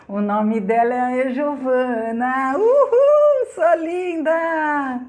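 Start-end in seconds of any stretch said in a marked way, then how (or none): 2.6-5.0 high whoop into a long drawn-out compliment; excited cheer. Real, not acted. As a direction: cheer a friend from afar, high and stretched. Cheers a viewer watching from abroad. (comemoração empolgada e aguda)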